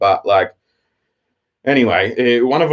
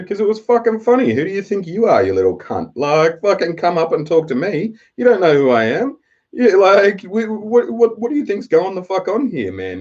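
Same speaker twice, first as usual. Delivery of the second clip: higher-pitched, incredulous telling-off; retold indignant outburst; 8 semitones higher than usual. Man confronts kid who questioned his laughing, indignant. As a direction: re-enact snapping at a cheeky kid. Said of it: "there was a switch flicked in me".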